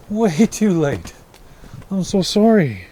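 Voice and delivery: apologizing to her late-fed horses, slow and cooing; stretched pet talk. Real, not acted. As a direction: stretch every word like soothing a foal.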